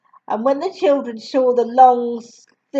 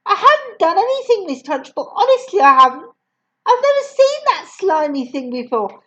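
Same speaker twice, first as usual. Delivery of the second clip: higher, fast protest; pleading child; 7 semitones higher, faster than usual. Acted. Matilda pleading with the headmistress, higher. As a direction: plead innocence.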